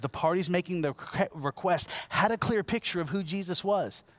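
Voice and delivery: usual speaking voice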